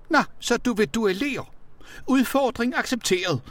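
very high, posh voice; uhyre fornem. A narrator's reading. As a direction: accept a duel with lofty, high-pitched poshness. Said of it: "talte Tonni Tokugle uhyre fornemt". (taler uhyre fornemt i højt toneleje)

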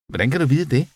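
pointed, high-pitched challenge; sharp question. A narrator's reading. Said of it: "spurgte hun skarpt".

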